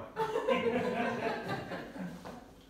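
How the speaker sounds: high laugh. Real, not acted. Laughs at name joke.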